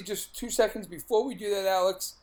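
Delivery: usual speaking voice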